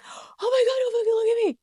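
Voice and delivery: very high, loud mock shriek; hammed-up horrified shriek; panicked shriek. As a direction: shriek it like a mortified parent caught naked. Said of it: "you can't be like".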